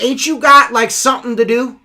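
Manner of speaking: loud, high challenge to a driver; confronting call-out. Retold or imitated. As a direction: call him out sharply.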